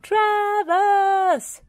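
high, loud yell drawn out; full-voiced yell. A narrator's reading. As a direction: bellow the name at the top of your voice. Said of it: "yelled Farmer Pickles at the top of his voice".

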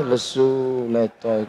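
low, soft and limp like a tired lecturer; lethargic voice. Acted. Preacher mocking a listless speaker, low and soft. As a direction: droop the words, no energy.

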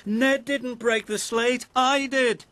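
high, urgent character voice; frantic high-pitched owning up; urgent confession. A narrator's reading. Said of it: "called Jack out of breath".

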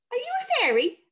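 high wondering question; curious boy. Acted. Tom asking the sprite if he is a fairy. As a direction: ask with wide-eyed curiosity.

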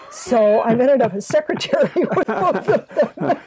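laughing through the words; gentle laughing admission. Real, not acted. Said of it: "You said that so gently".

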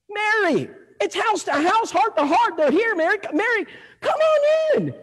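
very high, squealing character voice; overjoyed husband. Retold or imitated. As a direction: call out to the wife in comic excitement. Husband greets visitors, calling to wife.

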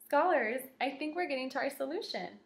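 normal talking voice

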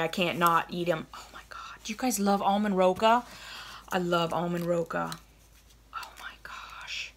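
hushed, guilty little confession; guilty whisper. Real, not acted. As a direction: whisper it like sneaking candy. Sneaking candy on camera.

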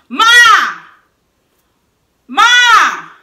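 loud high call across the house; drawn-out shouted Ma. Retold or imitated. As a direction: call for mom as if she's far off. Calling out to mother.

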